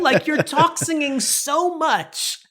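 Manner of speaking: high, slow, exasperated; mock exasperation. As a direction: drag it out, fed up. Mock rant at talk singing.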